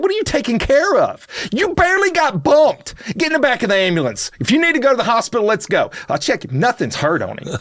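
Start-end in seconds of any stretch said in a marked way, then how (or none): 0.0-5.9 exasperated scolding. Retold or imitated. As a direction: chew him out, pitch way up. Scolding the faking driver.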